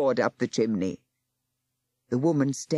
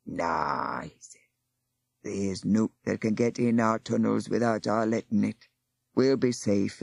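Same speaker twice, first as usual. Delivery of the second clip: put-on old Aufwader; 5 semitones lower than usual.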